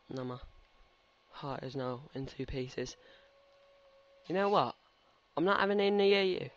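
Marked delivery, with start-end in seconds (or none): 4.3-6.5 higher, louder playful outburst; exasperated mock refusal; mock fed-up. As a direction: put your foot down, in jest. Fed up with a game character.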